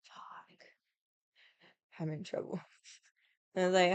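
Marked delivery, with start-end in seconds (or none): 0.0-2.6 imitating grandpa's caught-out whisper; whispered curse. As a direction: breathe it like a guilty kid. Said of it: "he kinda went this".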